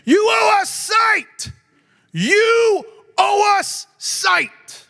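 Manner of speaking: shouted high, slow mock demand; entitled demand. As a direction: bark it like an entitled brat.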